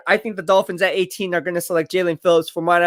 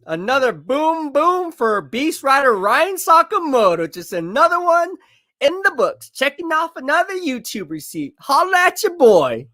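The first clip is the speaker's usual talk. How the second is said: higher, bragging hype-man shout of victory; hyped gloating; 7 semitones higher than usual. Real, not acted. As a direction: crow about the prediction coming true. Gloating over correct draft prediction.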